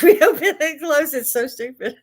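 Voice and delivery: cracking up at how silly it is; laughing about the gate the storm left standing. Real, not acted.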